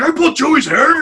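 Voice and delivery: loud, very high mock protest; outraged mimicry. Banned regular arguing with the bartender, mock outrage. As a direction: squawk it back in hammed-up outrage.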